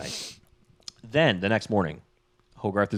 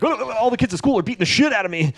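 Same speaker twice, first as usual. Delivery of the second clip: put-on high, rushed panicky kid voice; mock freak-out; 12 semitones higher, faster than usual. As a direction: spill it out in a hammed-up panic. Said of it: "Hogarth starts freaking the fuck out".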